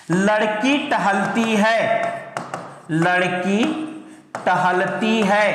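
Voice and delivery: drawn-out words, read piece by piece; stretched, deliberate example sentence; slow dictation. Shown as a demonstration. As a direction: read it out slowly as if writing it up. Teacher reads out example while writing.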